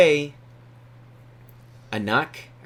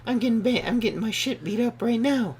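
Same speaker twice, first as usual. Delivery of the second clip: caller voice; 11 semitones higher than usual. Retold or imitated. Caller voiced in phone story.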